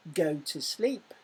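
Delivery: normal talking voice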